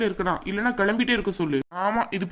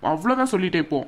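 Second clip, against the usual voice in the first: ordinary talk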